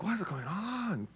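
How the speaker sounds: very soft, baffled aside; bewildered parents. Acted. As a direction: hush it, as if baffled.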